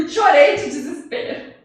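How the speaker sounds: loud, laughing confession; amused exclamation. Real, not acted. Storyteller laughing at her own despair over lost work, amused exclamation. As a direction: say it loudly through real laughter.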